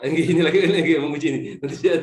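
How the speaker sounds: embarrassed laugh through the words; laughing on the phone. Real, not acted. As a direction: laugh it off while apologising. Interrupted by phone call during exam.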